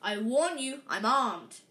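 loud, threatening warning. A narrator's reading. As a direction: warn them off loudly. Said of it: "he shouted".

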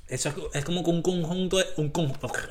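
tongue tangled by a hard candy; sucking a hard candy. Real, not acted. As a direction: stumble around a candy in the mouth. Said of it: "el caramelo, no me habla bien".

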